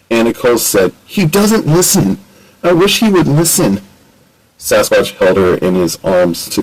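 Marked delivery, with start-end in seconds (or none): 1.0-3.8 upset. Acted. Upset Nicole after Ratchet leaves.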